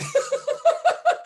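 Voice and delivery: short, high burst of laughter; excited high laugh. Real, not acted. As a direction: laugh out in delight at the idea. Laughs at his own claim.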